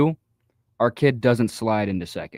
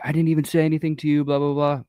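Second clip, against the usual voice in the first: higher, hurried mock protest; quick, pitched-up complaint, only half acted; retold freak-out; 5 semitones higher, faster than usual. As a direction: imitate an exasperated coach protesting. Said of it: "when my head coach freaked out".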